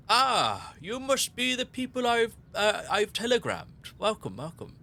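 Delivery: high eager greeting as the landlord; landlord character voice. Acted. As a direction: welcome them in as a fussy landlord. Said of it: "notices you enter and goes".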